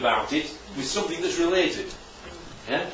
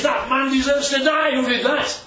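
upset outrage; 8 semitones higher, 3 dB louder than usual. Retold or imitated. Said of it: "David got really upset about that".

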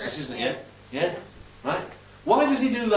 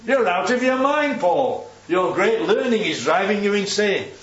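raised, exclaimed accusation starting high; acted shout; 5 semitones higher, 3 dB louder than usual. Hard-boiled Roman telling Paul he's mad, mock shouting. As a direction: throw it at him as a mock outburst. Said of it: "That's what he's saying".

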